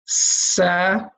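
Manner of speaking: sa with a light open a; Arabic letter sa. Shown as a demonstration. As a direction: say sa clearly as a demo. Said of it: "this letter is sa".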